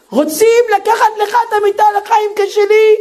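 high, shocked mother on the phone; alarmed mother. Retold or imitated. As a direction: play the horrified mother hearing the news.

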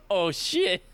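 startled and embarrassed realization; hammed-up surprise; mock shock. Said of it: "I'm like".

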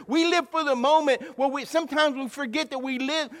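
high, loud, full-force sermon delivery; impassioned preaching. Real, not acted. Pastor declares belief in providence to church, impassioned preaching. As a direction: preach it out hard, punching key words.

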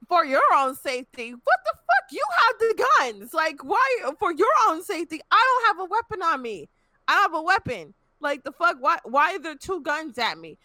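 high-pitched, indignant retold protest; incredulous protest, acted out in a squeal of outrage; outraged disbelief. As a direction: re-enact arguing back, voice leaping high. Retells protest at being handcuffed at gunpoint.

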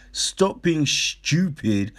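ordinary talk

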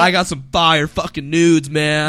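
mock tweet voice. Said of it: "He's like".

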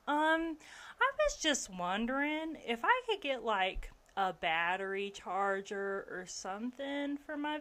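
sugary thick Southern belle, playing helpless; thick Southern accent. Charming the maintenance guys in a thick Southern accent. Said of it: "I put on my thickest Southern accent that I could".

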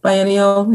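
"Biennial" opens like "buy", rising in pitch; biennial with a long i. Shown as a demonstration. Guest telling host how it is said, "Biennial" as buy-ennial. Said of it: "How do you say it?".

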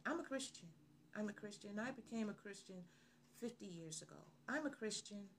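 very soft, meek mumbling admission; timid confession. Acted. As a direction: confess it as if hoping nobody hears. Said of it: "Why don't we have that confidence?".

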